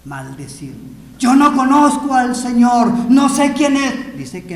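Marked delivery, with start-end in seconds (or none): 1.2-4.2 loud, high, vehement shout; acted angry outburst; cursing denial. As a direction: disown him at the top of your voice. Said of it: "Pedro empezó a maldecir".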